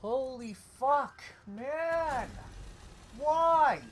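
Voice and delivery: very high, loud, slow scream of frustration; anguished scream. Real, not acted. At breaking point over being used, anguished scream.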